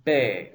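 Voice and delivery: BE as a drawn-out "bay"; long A sound. Shown as a demonstration. Teacher showing tsere yod, long BE. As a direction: hold the ay vowel in BE. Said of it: "This also is a long A sound".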